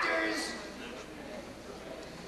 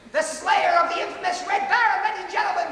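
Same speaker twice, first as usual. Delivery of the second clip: stage announcer; 6 semitones higher, faster than usual. Real, not acted. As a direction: present the performer with a big finish. Announces the next act.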